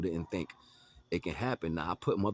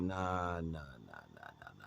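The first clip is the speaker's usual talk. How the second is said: nah repeated, dropping lower and softer to almost nothing; fading refusal; 6 semitones lower, 7 dB quieter, slower than usual. Retold or imitated. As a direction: let the nahs die away. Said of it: "I'm like".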